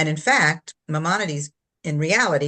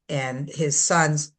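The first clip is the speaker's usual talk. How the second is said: ordinary delivery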